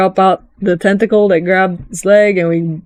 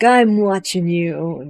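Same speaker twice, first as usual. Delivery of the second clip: menacing stare-down; 4 dB quieter, slower than usual. Retold or imitated. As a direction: drag it out like a suspicious glare. Menacing quote of game character.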